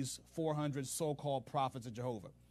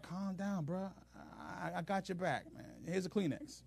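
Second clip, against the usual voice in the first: softer calming reassurance; soothing; 4 semitones higher, 5 dB quieter than usual. Retold or imitated. Jehoshaphat calming the frightened Ahab, softly. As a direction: settle him down gently.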